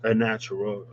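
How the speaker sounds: ordinary delivery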